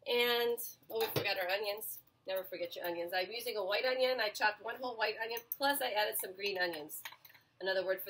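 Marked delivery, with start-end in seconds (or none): none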